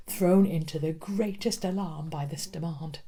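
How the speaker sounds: ordinary delivery, nothing marked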